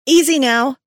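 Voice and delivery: high, loud call to someone below. A narrator's reading. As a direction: call a caution down the rope. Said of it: "she called down".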